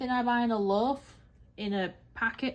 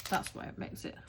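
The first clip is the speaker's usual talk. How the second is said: ordinary talk, nothing marked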